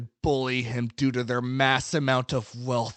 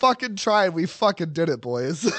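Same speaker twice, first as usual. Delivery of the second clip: ordinary delivery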